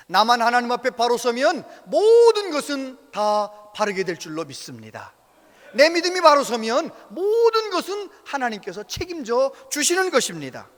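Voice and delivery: fervent preaching. Real, not acted. Preacher declaring faith to the congregation, fervent. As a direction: declare it with conviction.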